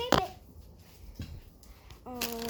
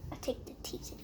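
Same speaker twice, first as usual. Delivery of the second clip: unvoiced whisper; 15 dB quieter, faster than usual. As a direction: whisper it like a secret. Secret aside in pretend play.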